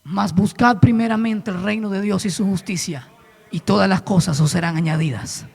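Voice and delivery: a hint of calm divine rebuke; lightly voiced tender answer; God's gentle correction. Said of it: "Y me dijo".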